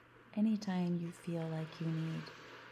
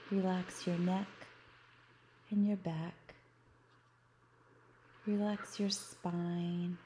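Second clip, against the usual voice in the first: guided meditation. Acted. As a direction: guide them gently into relaxation. Leads a guided beach visualization.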